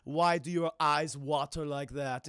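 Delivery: put-on slow Austrian-accented robot monotone; Arnold. Cyborg asking crying John about tears, slow Austrian accent. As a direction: imitate a puzzled Austrian-accented cyborg, slowly. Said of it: "Arnold turns around and he's like".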